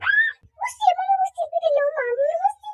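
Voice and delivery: high excited call to mom; excited shout. Acted. Child calling mother to see the house. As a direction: yell for mom to come look.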